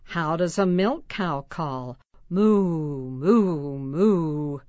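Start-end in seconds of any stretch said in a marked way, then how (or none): 2.3-4.6 put-on playful cow moo for kids. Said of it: "Moo like a cow".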